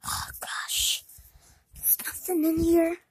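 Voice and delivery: breathy disappointed whisper. Real, not acted. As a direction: whisper it to yourself.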